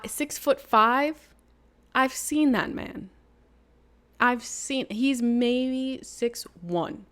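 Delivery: slow, high, disbelieving drawl; high and slow, not buying it; incredulous. Real, not acted. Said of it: "mocking the height".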